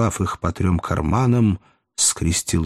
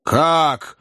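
astonished, higher-pitched exclamation; 7 semitones higher than usual. A narrator's reading. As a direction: blurt it out in surprise. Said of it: "удивился Боб Сойер".

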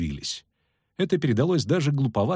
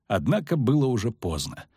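plain reading voice

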